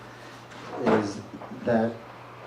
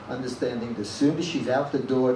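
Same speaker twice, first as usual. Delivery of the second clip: ordinary delivery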